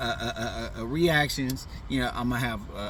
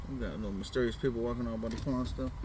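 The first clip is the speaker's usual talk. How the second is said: ordinary talk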